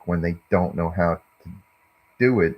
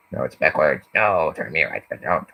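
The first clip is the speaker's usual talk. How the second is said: hat's voice; 2 semitones lower than usual. Pretend. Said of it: "this hat is telling me what to do".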